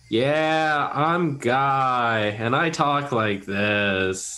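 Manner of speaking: slow, drawn-out, low-sinking impression; Guy. Player checking whether it's the same bard, slow drawling impression. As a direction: stretch the words lazily, letting the pitch drop. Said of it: "did he go something like this?".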